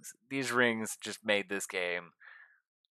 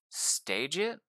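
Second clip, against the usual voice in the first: normal talking voice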